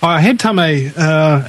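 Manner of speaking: ordinary delivery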